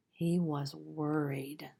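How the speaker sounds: slow, hushed and anxious; worried whisper. A narrator's reading. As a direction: breathe the worry out. Fear of being seen poor.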